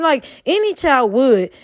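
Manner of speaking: normal talking voice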